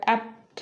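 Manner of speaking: "অ্যাপ্ট" with the p held, no puff; unaspirated cluster. Shown as a demonstration. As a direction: close the p straight into a light t in "অ্যাপ্ট". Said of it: "just উচ্চারণ হবে".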